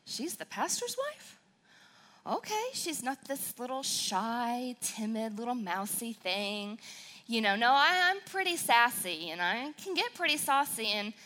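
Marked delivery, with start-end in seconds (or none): 0.1-6.8 imitating doubtful listener. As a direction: play a doubtful churchgoer. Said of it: "sitting there going".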